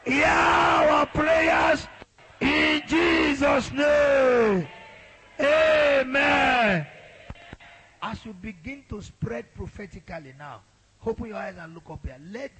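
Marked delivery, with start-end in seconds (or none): 0.0-6.9 high-pitched, urgent prayer call; fervent prayer leading. Real, not acted. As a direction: lead the congregation in prayer, stretching the final words. Leads prayer, high forceful.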